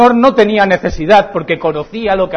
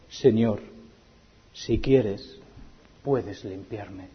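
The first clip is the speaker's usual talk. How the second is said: soft, slow, pleading; humble plea; 9 semitones lower, 11 dB quieter, much slower than usual. Acted. Leper humbly begging Jesus to heal him, soft and slow. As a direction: beg quietly on your knees.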